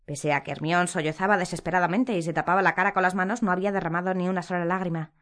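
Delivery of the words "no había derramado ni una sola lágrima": ordinary delivery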